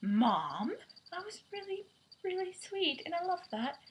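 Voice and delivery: high, soft tender mock Quill; a lightly voiced sweet son talking to his mom; imitating Quill to his mom. Quill telling his mom she was sweet, high and soft. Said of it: "It's like".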